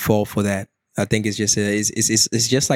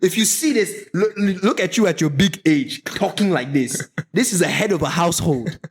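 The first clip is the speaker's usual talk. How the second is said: mock Ghanaian scolding; 8 semitones higher than usual. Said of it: "She said".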